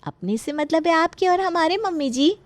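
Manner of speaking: high, sugary explanation; sly sweetness. Acted. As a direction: claim a share with feigned innocence. Daughter-in-law slyly claims a share.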